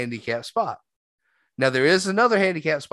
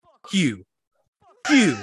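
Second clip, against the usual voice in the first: put-on old man cursing; 4 semitones higher, 3 dB louder than usual. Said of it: "He goes".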